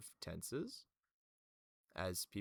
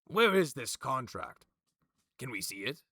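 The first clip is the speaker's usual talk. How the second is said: Magin; 3 semitones higher, 9 dB louder than usual. Acted. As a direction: demand proof, then ease off. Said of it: "Magin goes".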